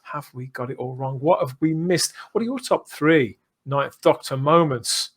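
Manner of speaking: ordinary talk, nothing marked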